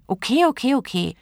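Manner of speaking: high, impatient okays; grudging. A narrator's reading. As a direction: concede in exasperation. Genie grudgingly gives in. (genervt einlenkend)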